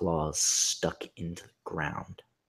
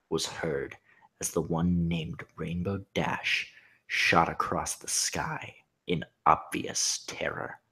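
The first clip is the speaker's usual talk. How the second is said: plain reading voice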